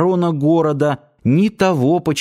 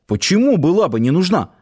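higher-pitched, hesitant youth; puzzled youth; 6 semitones higher than usual. A narrator's reading. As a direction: correct yourself and ask, pitched up. Youth hastily corrects himself, asking.

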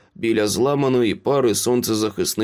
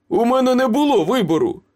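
high, quick protest; desperate self-defence; 13 semitones higher, 4 dB louder, faster than usual. A narrator's reading. Cornered Ace excuses himself to his accusers, desperate. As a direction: protest frantically, pitched right up.

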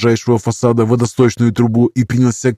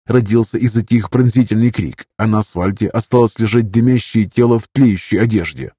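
usual speaking voice

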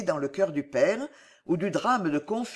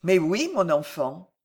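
ordinary delivery

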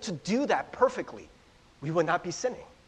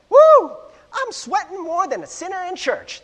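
high, loud hammed-up Southern belle; terrible Southern accent with a big high whoop; 11 semitones higher, 12 dB louder than usual. Pastor imitating a movie belle at a ball, Southern accent. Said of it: "That's my Southern accent".

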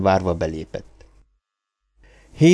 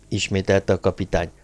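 usual speaking voice

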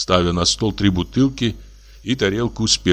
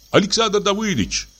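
high call through the window; 13 semitones higher than usual. A narrator's reading. Laevsky calls to sleeping Samoylenko through the window, high calling voice. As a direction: call into the dark house. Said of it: "позвал он".

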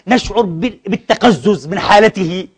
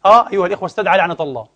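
normal talking voice

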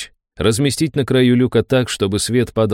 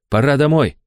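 higher, coaxing inner voice; urging inner voice; 4 semitones higher than usual. A narrator's reading. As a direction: press him to give up. (уговаривающий внутренний голос)

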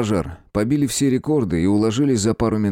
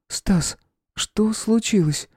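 put-on higher, slow girl's voice; young woman's voice; 12 semitones higher, much slower than usual. A narrator's reading. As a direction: voice the princess lightly. Stesha voiced by narrator. (голос молодой девушки)

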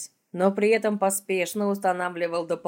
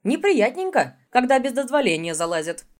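louder, higher reproach; indignant; 6 semitones higher, 6 dB louder than usual. Acted. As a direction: scold with mock hurt. Indignant scolding continues.

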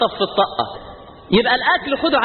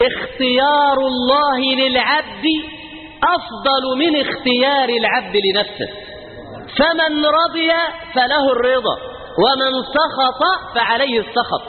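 solemn maxim; 5 semitones higher, slower than usual. Acted.

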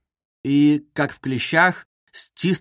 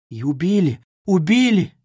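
second убили higher, louder, aghast; horrified disbelief; 4 semitones higher, 3 dB louder than usual. Acted. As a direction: gasp it out in horror. Соня в ужасе переспрашивает. (в ужасе, второе «убили» выше и громче)

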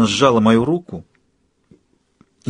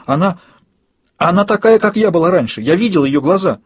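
high, louder, agitated outburst; exclaiming; 8 semitones higher, 4 dB louder than usual. A narrator's reading. Woman alarmed by the eyes she saw, exclaiming. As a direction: blurt it out in alarm. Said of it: "воскликнула женщина".

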